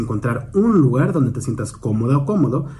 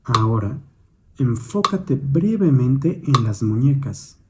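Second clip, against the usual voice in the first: calm, measured meditation guidance with long pauses; guided meditation. Real, not acted. Instructor guiding a listener's attention to the hands, meditative. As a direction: guide gently, leaving space after each prompt.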